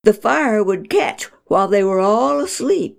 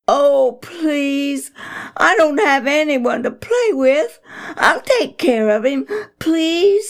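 put-on high, pleading boy's voice; pleading child; 8 semitones higher, 3 dB louder than usual. Boy pleads with dad to keep the puppy. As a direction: beg with everything he has. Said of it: "Sammy pleaded".

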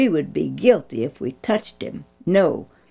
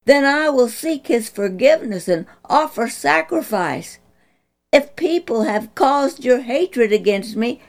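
earnest plea; 8 semitones higher, 4 dB louder than usual. Acted. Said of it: "David's earnest plea".

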